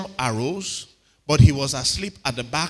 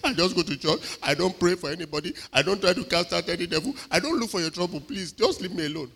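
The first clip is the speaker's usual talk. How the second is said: mock timid pleading. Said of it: "You don't say all this nice one".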